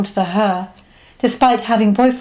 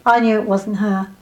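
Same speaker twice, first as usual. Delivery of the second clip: ordinary delivery, nothing marked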